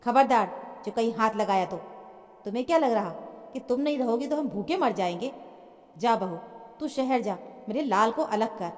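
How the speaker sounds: ordinary delivery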